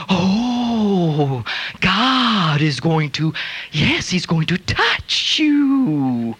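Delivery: lightly mock a faith healer. Scoffers mocking the woman healer, slow and sneering.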